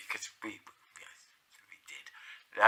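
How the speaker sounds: whispered, slow, a cheeky confession; hushed guilty admission; whispered aside. Real, not acted. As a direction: let it slip as a secret. Sly admission about ordering beers.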